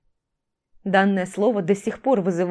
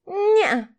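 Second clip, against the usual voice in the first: put-on high playful little girl refusal; stubborn child; 12 semitones higher, 4 dB louder than usual. A narrator's reading. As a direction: refuse like a cheeky toddler. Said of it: "продолжала упираться Катя". (упрямый детский голос)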